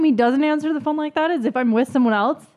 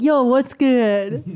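slow, louder mock phone greeting; imitated boyfriend greeting; 3 semitones higher, 5 dB louder, much slower than usual. Imitating how he answered her calls, exaggerated and drawn out. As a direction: stretch out a hyped-up greeting. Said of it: "you'd answer the phone and be like".

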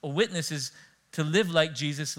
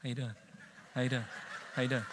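soft, friendly hello to each passerby; quiet polite greeting; 3 semitones lower, 9 dB quieter, faster than usual. Retold or imitated. Pastor greeting people eyeing him, softly and calmly. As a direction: nod and greet softly, staying calm. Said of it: "I'm like".

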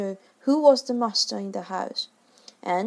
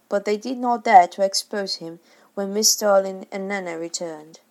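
usual speaking voice

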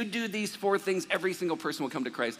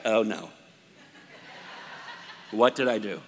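low, lightly voiced dismay; dismayed repairman; 7 semitones lower than usual. Pastor voicing the repairman's dismay after Emmanuel, lower and half-laughing. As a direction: mutter it like he regrets asking. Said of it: "that look on his face, like".

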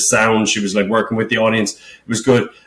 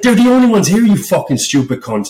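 retold angry rant; 7 semitones higher than usual. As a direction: fire it back at the sound guy in disbelief. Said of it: "I was fucking fuming".

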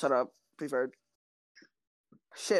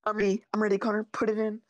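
high, loud taunting I'm ready; eager taunt; 8 semitones higher, 5 dB louder, faster than usual. Real, not acted. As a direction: egg your friend on, pumped up. Taunting opponent to play.

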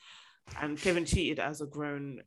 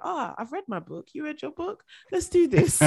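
playful couple voice; 9 semitones higher, 4 dB louder than usual. Retold or imitated. Couple voiced in imagined scene.

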